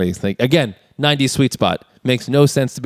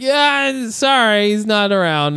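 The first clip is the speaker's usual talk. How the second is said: mock apology; 9 semitones higher, slower than usual. Will answers the sheriff.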